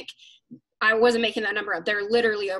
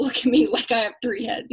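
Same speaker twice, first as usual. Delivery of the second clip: usual speaking voice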